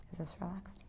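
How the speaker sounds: soft whispered question; whispering. Real, not acted. Adjuster quietly checking.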